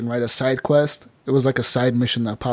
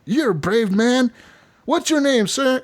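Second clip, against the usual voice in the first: higher-pitched imitation of a showman; stage magician; 8 semitones higher, slower than usual. Magician welcoming a brave volunteer, showman-like. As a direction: address the volunteer as part of the act.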